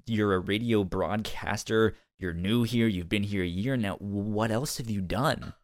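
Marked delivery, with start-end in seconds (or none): none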